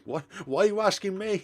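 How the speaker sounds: high, loud, cracking up; laughing protest. Acted. Asked whether she should buy a plush, laughing incredulity. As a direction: protest through bursts of laughter.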